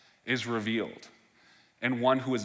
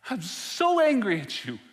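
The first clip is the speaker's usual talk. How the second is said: voiced anger; 7 semitones higher than usual. Martha crying out to Jesus, anguished anger. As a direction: let the hurt burst out as Martha.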